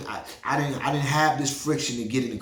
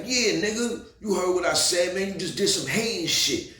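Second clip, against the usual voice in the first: much higher, heated comeback to the bouncer; confronting the bouncer in front of the line, heated; retold angry comeback; 7 semitones higher than usual. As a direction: fire it back at him. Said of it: "I was like".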